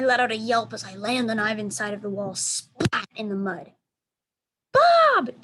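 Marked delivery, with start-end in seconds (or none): 4.7-5.4 very high, loud scream of the name; frightened scream. A narrator's reading. Julia screaming for Bob as she is dragged away, high loud scream. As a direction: scream for the dog in fear. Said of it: "Julia screams".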